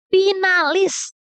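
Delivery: finalis stretched slow and high; drawn-out emphasis. Real, not acted. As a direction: pull out finalis long and high. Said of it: "repeated emphatic word". (finalis diucapkan panjang dan tinggi)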